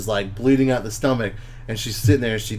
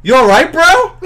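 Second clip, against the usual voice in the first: high, loud mock concern; concerned teasing; 9 dB louder than usual. Heather on the couch checking on him, loud teasing concern. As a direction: ask if he's okay, half laughing. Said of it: "Heather's on the couch was like".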